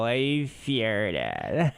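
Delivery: put-on relaxed valley girl "I farted"; tossed-off valley girl drawl; casual valley girl. Imitating the girl's casual confession, valley girl accent. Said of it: "It was so casual".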